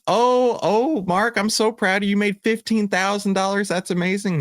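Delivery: very high, cooing proud mother; gushing mom. Retold or imitated. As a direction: gush over him like a thrilled mom. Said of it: "She goes".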